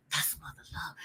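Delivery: very soft whisper; whispered aside. Retold or imitated. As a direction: whisper it almost inaudibly, as an aside. Retold aside among teenagers.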